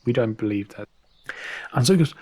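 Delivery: usual speaking voice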